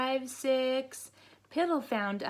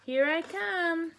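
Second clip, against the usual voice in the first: higher, slow sing-song call; 8 semitones higher, slower than usual. Acted. Mama Duck calling to her hiding ducklings, sing-song. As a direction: call across the pond playfully. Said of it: "Mama called out".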